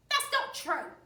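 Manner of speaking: put-on high, loud old woman; old wife voice. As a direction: squeak it out like a fussy old wife.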